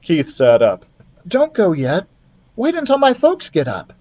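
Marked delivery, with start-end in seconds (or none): 1.2-3.9 put-on lightly higher eager boy; boy asking the mouse to stay; a hint of an eager boy; boy's voice. Keith voiced.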